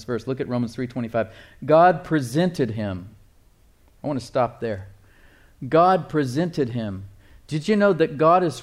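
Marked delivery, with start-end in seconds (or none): 1.6-3.1 deliberate, drawn-out scripture reading; slow reading. Real, not acted. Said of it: "We're gonna read it slowly". 5.7-7.0 slow, weighted scripture quote; slow reading. Real, not acted. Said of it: "We're gonna read it slowly".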